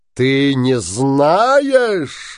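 slow, loud angry shout; крик. A narrator's reading. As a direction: roar it at the wife slowly. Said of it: "закричал Синяя Борода". (громкий гневный крик)

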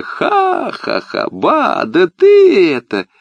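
high, slow burst of laughter; laughing tsar. Acted. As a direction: laugh it out, then exclaim. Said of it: "засмеялся".

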